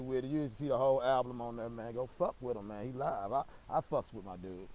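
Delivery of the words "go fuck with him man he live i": ordinary delivery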